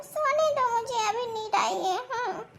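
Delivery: very soft, half-asleep plea; sleepy mumble. Acted. Wife begging her husband to let her sleep, sleepy mumble.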